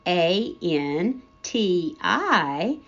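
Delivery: letters spaced out one by one, slow; slow letter-by-letter spelling; spelled out slowly. Acted. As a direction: spell it like teaching a child.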